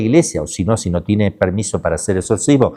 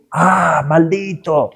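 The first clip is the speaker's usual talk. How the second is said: cursing demon; 6 semitones higher, much slower than usual. Retold or imitated. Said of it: "el demonio dice".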